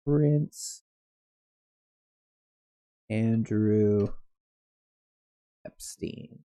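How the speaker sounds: very soft, drawn out word by word; absent search muttering. Real, not acted. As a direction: sound out each search term to yourself while typing. Typing a search aloud.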